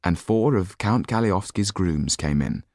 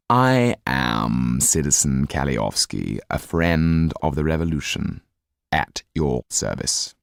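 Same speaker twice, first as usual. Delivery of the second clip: a lower, slow, mocking aristocrat voice; cold sneering count; 5 semitones lower than usual. Count scolding the Marquis, low and coldly mocking. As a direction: talk down to him, icy and low.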